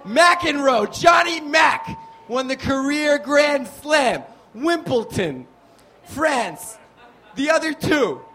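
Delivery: high, loud triumphant announcement; shouted quiz answer. Acted. Comic giving silent crowd the quiz answer, shouted.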